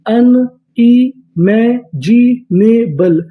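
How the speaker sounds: Unimaginable stretched out slowly, syllable by syllable; slow drawn-out word. Shown as a demonstration. Teacher to students, drawing unimaginable out slowly. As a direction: draw out unimaginable slowly for the class. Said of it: "पूरी meaning".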